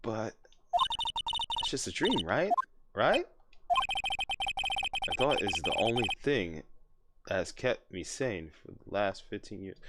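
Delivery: soft, hushed inner monologue; quiet story narration. Acted. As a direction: read it like a haunted memory. Reads game narration softly.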